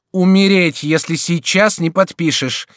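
high, loud, cutting command; sharp, imperious. A narrator's reading. Duchess threatening the king into signing, sharp and imperious. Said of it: "возразила резким повелительным голосом герцогиня".

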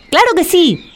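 high, simple-minded fool; high silly Juan voice. Acted. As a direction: play the cheerful village fool. Juan el Bobo's voice.

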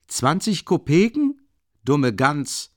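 huffy offended retort, mildly hammed. A narrator's reading. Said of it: "rief er beleidigt aus".

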